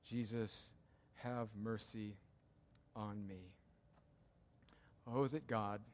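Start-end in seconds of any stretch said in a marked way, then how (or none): 0.0-3.5 soft, low humble prayer; humble beggar asking for mercy; humble plea. Retold or imitated. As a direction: pray it quietly like a beggar. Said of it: "like a humble beggar".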